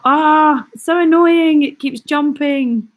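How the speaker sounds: high, loud exasperated groan of complaint; annoyed outburst. Real, not acted. Frustrated that the comment feed jumps.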